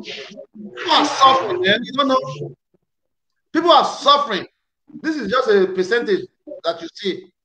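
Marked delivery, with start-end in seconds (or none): 0.0-4.5 lament. Real, not acted.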